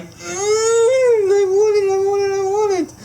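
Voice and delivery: high, loud, crying whine that speeds up; hammed-up high crying plea; mock whining. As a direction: whimper it like a kid begging for a toy. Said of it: "I was like".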